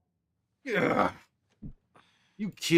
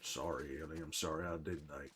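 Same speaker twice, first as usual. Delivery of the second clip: soft apology; 11 semitones lower, 15 dB quieter than usual. Real, not acted. Apologizing softly to Ellie.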